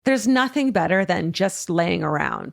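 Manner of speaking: upbeat sponsor read. Acted. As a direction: sell it like a radio spot. Reads sponsor ad.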